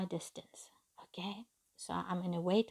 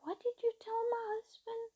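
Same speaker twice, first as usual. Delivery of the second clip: much higher, worried questioning; anxious; 14 semitones higher, 4 dB quieter than usual. Retold or imitated. Student asking her Arabic teacher what happened, anxious. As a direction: press for answers in distress.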